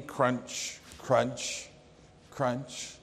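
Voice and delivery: quiet imitated chewing crunches; apple crunches. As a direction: crunch slowly three times. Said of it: "took crunch out of his apple".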